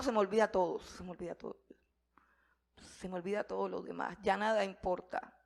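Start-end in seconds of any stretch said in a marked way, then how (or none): 0.8-4.2 a hushed, awed whisper. Real, not acted. Preacher telling the congregation his worries vanish, whispered. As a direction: let it fall to a whisper. Overwhelmed by Jesus' promise.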